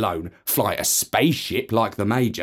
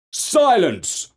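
raised, higher call; judge calling for silence; 7 semitones higher than usual. Acted. Judge silencing the noisy court, raised call. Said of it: "called the judge".

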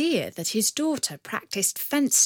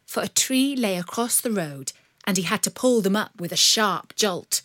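ordinary delivery

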